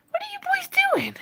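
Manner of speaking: squeaky high voice for pets; pet talk. Real, not acted. Talking to the pet rats.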